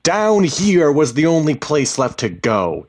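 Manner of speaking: loud, high angry retort; heated, full-voiced comeback; shouting back. A narrator's reading. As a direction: shout back in your own defence. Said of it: "he shouted back".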